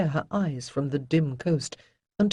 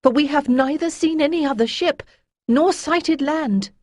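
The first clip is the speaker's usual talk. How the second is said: put-on high, louder voice for a young crew member; worried young character; 10 semitones higher, 5 dB louder than usual. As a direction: speak up in a high, anxious young voice.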